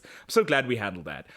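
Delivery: normal talking voice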